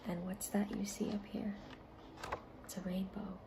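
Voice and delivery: soft unvoiced whisper, sharing a secret. Reader whispering to children about the rainbow picture, hushed. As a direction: hush it like pointing at a hidden wonder.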